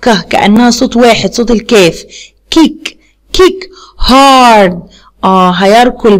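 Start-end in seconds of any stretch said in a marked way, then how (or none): 2.5-3.7 كيك said slowly, both k sounds hit as one clear k; k sound shown. Shown as a demonstration. Teacher showing the class how to say the k in كيك. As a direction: sound out كيك slowly with a crisp k at each end. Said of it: "هنطقها ك ساوند".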